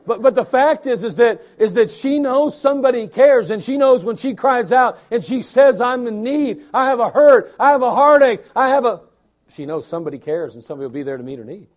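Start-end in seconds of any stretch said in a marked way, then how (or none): 0.0-9.0 loud, high preacher cry; preaching at full force. Acted. Preacher calling out the baby's need to the congregation. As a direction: cry it out to the room.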